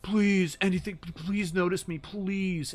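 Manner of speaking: high, loud imitation begging; mock desperate pleading. Imitating guys in her DMs begging, mock desperate. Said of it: "this kind of desperate pleading".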